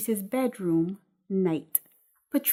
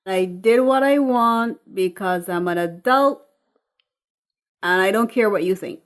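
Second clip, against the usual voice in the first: defiant and louder, fast at the end; Patrice; 6 dB louder than usual. Acted. Patrice to her mother, defiant and louder. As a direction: snap back at your mother. Drunk daughter defies mother.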